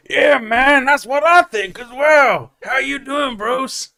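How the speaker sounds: high, loud, hammed-up American; mock American accent. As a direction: holler back as an over-the-top American. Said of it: "and then I'll go".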